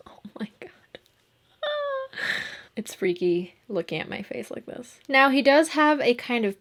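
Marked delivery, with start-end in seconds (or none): 0.1-0.7 laughing whisper. Real, not acted. Laughing at own costume face. 1.6-2.7 high-pitched squeal of laughter. Real, not acted. Laughing at own made-up face.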